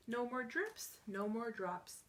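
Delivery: usual speaking voice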